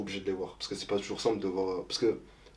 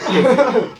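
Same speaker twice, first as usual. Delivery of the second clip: high burst of laughter; sudden loud laugh; 16 dB louder than usual. Real, not acted. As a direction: laugh at your own slip. Laughs at own slip.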